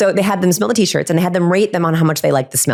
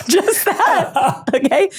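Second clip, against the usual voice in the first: giggling, much higher; laughing; 11 semitones higher, much slower than usual. Real, not acted. Laughing at her own demo for the host.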